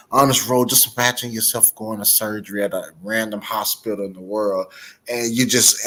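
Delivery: normal talking voice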